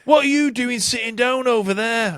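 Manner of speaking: hammed-up pop star scolding the crowd. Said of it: "pretty good fucking [name] impression".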